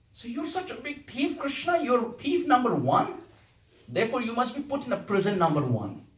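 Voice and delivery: mock sentencing of a thief; sarcastic accusation. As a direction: pass judgement in playful sternness. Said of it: "this is a sarcastic way of saying".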